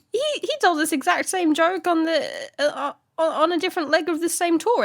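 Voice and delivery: high, whiny mock indignation; outraged heckler. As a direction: protest in a high put-on voice. Heckler at stand-up show.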